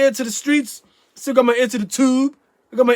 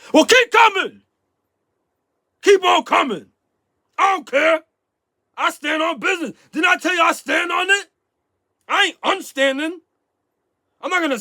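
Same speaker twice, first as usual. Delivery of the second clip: mock angry shouting; 8 semitones higher than usual. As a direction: yell it like a showy challenge. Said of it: "I just wanted to put on a little show for y'all".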